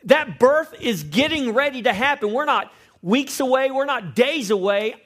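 high, loud preacher's build; full-force preaching. Acted. Pastor preaching to the congregation, loud and high. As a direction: preach it with rising urgency.

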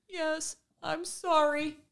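put-on high, slow little-girl apology; mock small sorry voice; imitating tearful daughter. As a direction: voice the child saying sorry meekly.

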